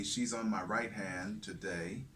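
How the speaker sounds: normal talking voice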